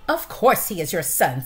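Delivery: loud, impatient wife's retort; scolding mother mouse. Acted. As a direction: brush off her husband loudly.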